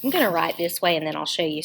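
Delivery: ordinary talk, nothing marked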